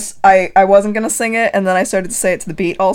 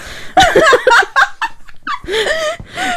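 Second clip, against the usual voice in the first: high laugh. Real, not acted. Laughing at joke.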